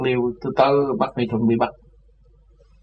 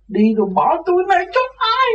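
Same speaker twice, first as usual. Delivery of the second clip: very high, wailing cry of abandonment; mock wailing; 4 dB louder than usual. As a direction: wail like a child left behind. Imitating Cô Nhi crying out. (giả giọng khóc than the thé)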